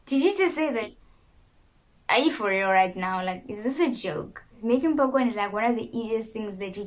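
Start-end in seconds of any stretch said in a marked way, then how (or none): 0.1-4.4 high, incredulous telling-off of oneself; mock self-rebuke. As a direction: rebuke yourself in playful disbelief. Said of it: "had to literally rebuke myself".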